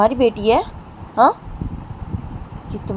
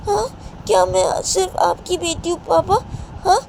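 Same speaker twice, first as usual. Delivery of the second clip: put-on high, pleading little girl's voice; a hurt child begging her papa; little daughter; 11 semitones higher than usual. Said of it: "बोलती है कि". (बेटी सोनम पापा से ऊँची, मिन्नत भरी आवाज़ में पूछती है)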